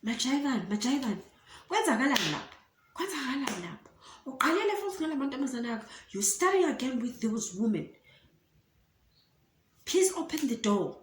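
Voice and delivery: a hint of a nagging mother knocking; imitating his mom. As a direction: call through the door like a fed-up mom. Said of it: "his mom came, started knocking".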